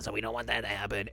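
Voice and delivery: ordinary talk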